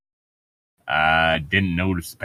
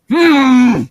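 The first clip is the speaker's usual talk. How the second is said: loud high villain hum; 11 dB louder than usual. Acted. Villain musing.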